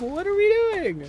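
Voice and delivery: high, loud disbelieving wail; exasperated cry. Real, not acted. Streamer fed up with passive enemies, exasperated wail. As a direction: throw the question up high in exasperation.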